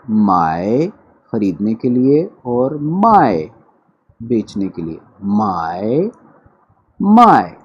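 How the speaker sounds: 买 then 卖; both tones of mai. Shown as a demonstration. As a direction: contrast buy and sell tones. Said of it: "दोनों को इकट्ठे सुन लीजिए".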